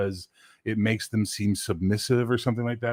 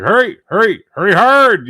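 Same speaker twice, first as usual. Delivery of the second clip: much higher, very loud barking "Hurry"; mock butch curler shout; 11 semitones higher, 13 dB louder than usual. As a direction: bark it like a tough curling skipper. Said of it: "butch North American ... female curlers".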